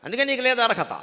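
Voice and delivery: harsh stepmother. Acted. Stepmother scolding the boy.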